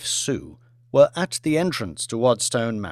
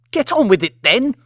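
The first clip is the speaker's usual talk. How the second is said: high, loud snapped command; imperious shout; 9 semitones higher, 6 dB louder than usual. Acted. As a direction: drive the staff back to work. Lady Elizabeth orders staff back to work.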